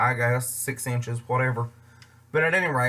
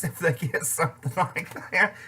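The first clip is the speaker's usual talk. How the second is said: words breaking up with amusement; laughing; 4 semitones higher than usual. Real, not acted. Picturing strangers' faces at the prank, laughing. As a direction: talk through a laugh.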